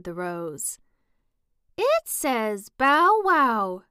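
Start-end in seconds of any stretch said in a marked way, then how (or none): 1.8-3.8 high, loud bow wow call; daisy crying out. Acted. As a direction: call it out like an eager little daisy. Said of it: "cried a daisy".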